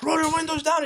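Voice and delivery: high-pitched mock police yell; imitating a screamed command; retold screaming. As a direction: shout it as the cop, without real volume. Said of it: "He's screaming all the way".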